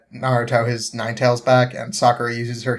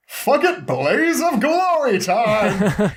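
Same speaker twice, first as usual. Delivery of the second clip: pitched-up, drawn-out mock war cry; gung-ho battle cry; 9 semitones higher, much slower than usual. Might Guy going all out, mock heroic yell. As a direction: belt it like a reckless hero. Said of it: "Might Guy is like".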